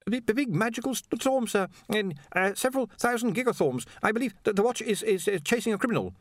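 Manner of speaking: high, stammering nervous assistant; flustered underling. A narrator's reading. Ponder explains the police to his master, flustered. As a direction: stumble through the report, deferentially.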